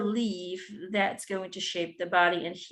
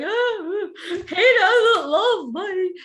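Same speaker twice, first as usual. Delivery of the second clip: high, slow, half-laughing fake sob; mock crying cells; 10 semitones higher, 8 dB louder, much slower than usual. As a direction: whimper it like hurt little cells, laughing through it. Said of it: "it'll be like".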